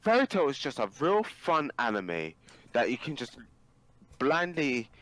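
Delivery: high, loud, slow protest; indignant defence. Real, not acted. Fan defending Fairy Tail to his friend. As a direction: plead for the show.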